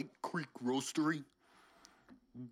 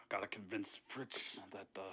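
softer, lower sneaking whisper; 6 semitones lower, 6 dB quieter than usual. Acted. Intruder in the kitchen whispering to himself. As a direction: breathe it to yourself while sneaking.

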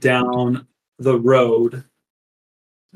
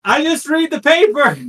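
town crier; 3 dB louder than usual. Acted. Crier answers heckler.

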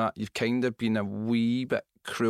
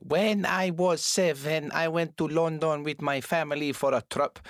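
put-on slow, careful Scottish-accented schoolkid English; kid with Scottish accent; 4 semitones higher, slower than usual. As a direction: recite it like a Spanish kid copying a Scottish teacher. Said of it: "the wee kid coming up and saying".

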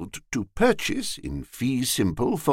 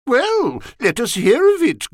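high, loud, eager exclamation; hearty urging; 13 semitones higher, 6 dB louder than usual. A narrator's reading. Mister Dixon urges his friend to tell his plan, hearty. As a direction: urge him on, pitched high and loud.

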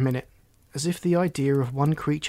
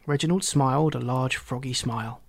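plain reading voice

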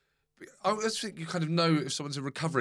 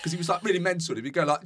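usual speaking voice

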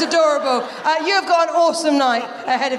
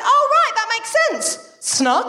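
squeaky high surprised doctor voice, then flat; a doctor peering down, mock surprised; doctor's matter-of-fact verdict; 8 semitones higher, slower than usual. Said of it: "she popped up and went".

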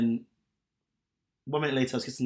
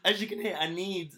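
higher-pitched, slow, laughing appeal; laughing plea; 5 semitones higher, slower than usual. Real, not acted. Podcaster jokes to listeners that he needs messages, laughing plea. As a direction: beg jokingly through your laughter.

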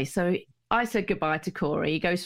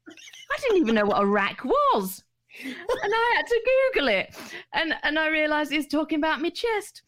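shocked; 11 semitones higher than usual. Real, not acted. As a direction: voice shoots way up in disbelief. Retelling her shock, pitched way up.

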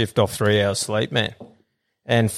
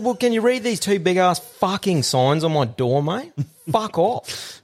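higher, aggressive rant at the cleaner; heated telling-off; 7 semitones higher than usual. Retold or imitated. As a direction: lay into him, pitched up.

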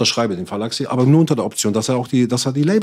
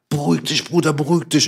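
imitated urgent appeal, pitched up; mock-worried, higher voice; urgent pacifying; 5 semitones higher than usual. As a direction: act out trying to calm an angry friend. Others pleading with him, acted.

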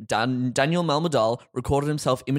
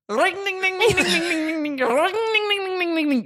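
high-pitched ringing ding-ding; [name] impression. Shown as a demonstration. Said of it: "can you give us your best rendition of [name]".

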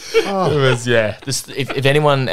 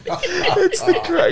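high giggling laugh breaking through the words; laughing too hard to get it out; slower than usual. Real, not acted. Host cracking up over the cardiologist story, laughing. Laughing at killing Archie joke.

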